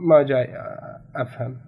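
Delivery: ordinary talk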